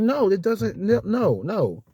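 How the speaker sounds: a touch of heated refusal; refusing. Retold or imitated. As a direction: cut them off, refusing to calm down. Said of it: "I was like".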